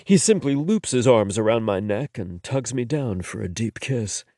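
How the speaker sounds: ordinary delivery, nothing marked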